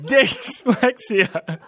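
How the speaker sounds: "Dyslexia" very slow through real laughter; laughing. Real, not acted. Host fails the take, cracking up on dyslexia. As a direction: break up laughing on the word. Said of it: "I cannot say it".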